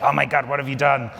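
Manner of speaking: put-on loud, high Bronx accent, laughing; exaggerated New York Bronx accent. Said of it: "she's obviously from the Bronx".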